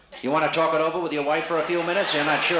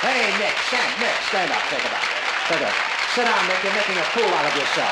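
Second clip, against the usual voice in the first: loud, high comic commands; playful barked scolding; mock bossy shouting; 5 semitones higher, 5 dB louder than usual. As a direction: holler orders at a stage volunteer. Orders volunteer up, then sit down.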